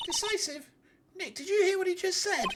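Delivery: high, incredulous sidekick voice; indignant assistant. Acted. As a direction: squeak it in disbelief, pitched far up. Voices Nick's indignant assistant in court.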